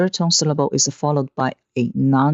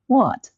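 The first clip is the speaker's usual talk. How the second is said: WHAT starting high and dropping sharply; falling tone; 10 semitones higher than usual. Shown as a demonstration. Teacher shows Mandarin fourth tone, WHAT falling hard from high. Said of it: "Fourth tone falling".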